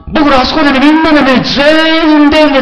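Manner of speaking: preacher's ecstatic build, high and loud; rising excitement. Real, not acted. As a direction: lift it up like being carried to heaven. Rapture, raised up together.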